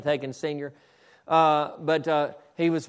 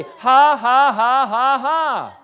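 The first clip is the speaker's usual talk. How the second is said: loud, high, shouted "ha, ha, ha"; defiant mock laugh; 7 semitones higher, 9 dB louder, much slower than usual. Man laughing in the devil's face, loud defiant mock laugh. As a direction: fire off triumphant fake laughs at full voice. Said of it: "I'm laughing at you".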